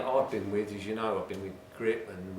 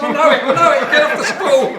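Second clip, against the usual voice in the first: high, loud, squeaky mock customer; excited customer voice; 16 dB louder than usual. Shopkeeper mimicking a keen customer, high and loud. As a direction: ham up the pushy punter. Customer over-enthusiastic at demo.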